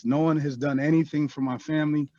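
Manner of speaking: normal talking voice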